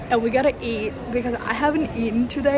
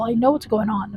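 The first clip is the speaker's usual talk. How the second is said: ordinary talk, nothing marked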